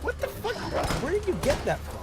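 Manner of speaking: shocked disbelief. Real, not acted. Shocked reaction to the story.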